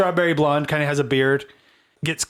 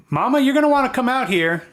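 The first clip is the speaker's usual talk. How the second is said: farmer; 11 semitones higher, 4 dB louder than usual. Acted.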